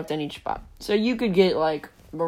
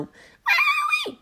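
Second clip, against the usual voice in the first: shrieked way higher, hammed-up; over-the-top squeal; 3 dB louder than usual. Kid overdoing a classmate's voice as a shriek. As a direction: overshoot into a shriek. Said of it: "Okay, that's offensive".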